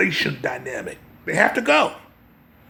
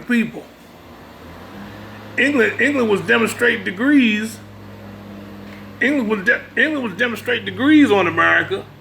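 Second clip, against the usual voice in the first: sermon delivery, pitch raised and pushed; preaching; 6 semitones higher than usual. Acted. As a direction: preach it to the congregation with conviction. Preaching to listeners about history.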